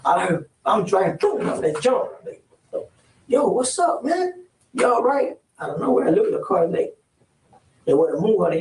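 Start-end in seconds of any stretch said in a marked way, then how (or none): 3.3-5.4 high, alarmed check-in after a bang; jolted, calling out to his friend; startled alarm. Retold or imitated. Startled by the gun going off, he calls out high and alarmed. Startled by a gunshot while driving.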